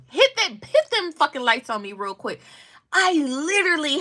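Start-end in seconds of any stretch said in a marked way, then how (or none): none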